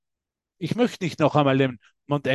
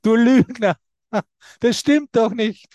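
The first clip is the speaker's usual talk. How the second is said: loud, higher mock accusation; mock heckler; 5 semitones higher, 5 dB louder than usual. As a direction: call him out like a doubting listener. Vorgestellter Zuhörer widerspricht dem Sprecher. (gespielter Zwischenrufer, laut)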